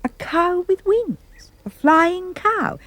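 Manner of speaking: high, slow, marvelling exclamation; awed wonder. A narrator's reading. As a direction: draw it out high in amazement. Narrator marvels at winged cow.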